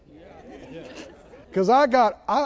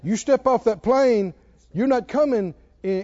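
ordinary talk, nothing marked

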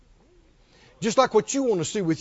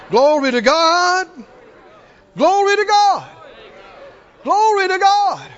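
high, loud call to the congregation; shouted praise; 9 semitones higher, 7 dB louder than usual. Real, not acted. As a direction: rouse the church with full-voiced praise.

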